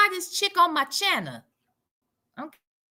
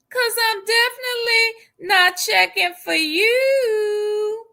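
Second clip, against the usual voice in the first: sassy sing-song; 5 semitones higher than usual. Acted. Sing-song jab ending her comeback.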